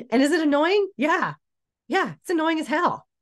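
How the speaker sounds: high, sing-song mock annoyance; mock exasperation. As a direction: play up being annoyed, half joking. Mock-exasperated aside about skinny rival.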